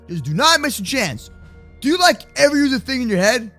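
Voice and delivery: ordinary talk, nothing marked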